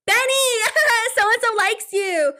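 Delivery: high, loud sing-song teasing with a giggle; mocking taunt. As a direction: tease her like a schoolyard bully. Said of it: "decided to taunt me".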